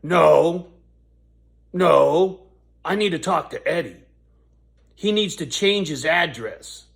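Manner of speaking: mock the rude lady shouting him down; a loud imitation of the angry neighbour lady; rude insistent lady. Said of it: "she's being really rude".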